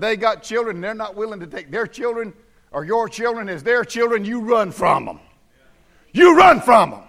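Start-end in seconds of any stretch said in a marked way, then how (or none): 6.1-7.0 "run" hit hard and much louder; loud emphatic repeat. Shown as a demonstration. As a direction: punch it out at the congregation. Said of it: "I'll say it emphatically".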